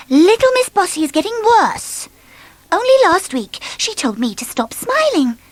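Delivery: high, bright complaint; cheery Miss Sunshine. Acted. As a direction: gossip in a sunny voice.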